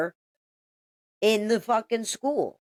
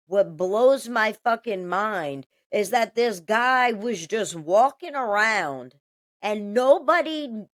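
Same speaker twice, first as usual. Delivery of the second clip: ordinary talk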